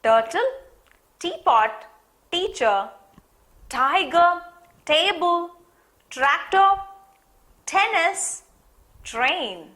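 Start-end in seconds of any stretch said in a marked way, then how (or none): 0.0-8.4 "Turtle", "tiger", "tractor" with a punched, higher T; rising list, louder on each t word; stressed t words. Shown as a demonstration. Teacher calling out t words to children, hitting each T. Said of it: "tell me few words with t?".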